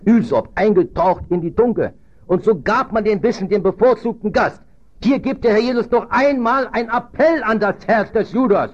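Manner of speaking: high, rising preacher's voice; forceful preaching. Real, not acted. Preacher tells congregation of the last appeal to Judas, forceful. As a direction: build into an urgent pulpit appeal.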